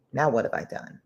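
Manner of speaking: plain reading voice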